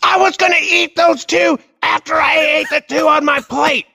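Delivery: put-on high shouting kid voice; mock kid shout; imitating an angry child. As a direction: yell it like a furious five-year-old. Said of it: "He goes".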